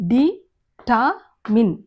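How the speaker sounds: డిటామిన్ broken slowly into di, taa, min; slow teaching model of డిటామిన్, R left silent; syllable-by-syllable. Shown as a demonstration. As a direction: spell out the sounds of డిటామిన్ with gaps.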